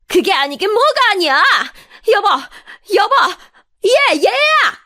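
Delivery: high, loud cries for backup; outraged yelling. Acted. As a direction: snap back, then call out frantically. Furious mother-in-law calls husband, son.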